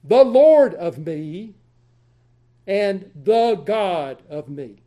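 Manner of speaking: slow and deliberate: "The Lord of me and the God of me"; slow, weighty literal rendering; 1 slow literal reading. Shown as a demonstration. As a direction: read "The Lord of me" word by word. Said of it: "is literally".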